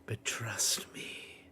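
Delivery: low, slow, whispered relish; Frollo. Acted. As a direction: whisper it as if savouring a morsel. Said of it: "Frollo steps in and goes".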